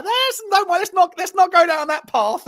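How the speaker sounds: high-pitched, exaggerated retort; mock protest. Streamer retelling his argument with a friend, mock protest. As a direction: object in a squeaky high voice, hammed up.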